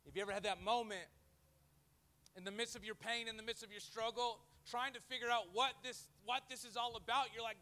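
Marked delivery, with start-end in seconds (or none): none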